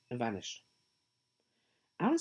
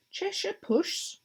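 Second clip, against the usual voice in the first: timid; much slower than usual. A narrator's reading. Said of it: "she began rather timidly".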